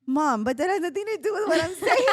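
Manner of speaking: half-laughing mock complaint from an annoyed daughter. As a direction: laugh while playing the fed-up teen.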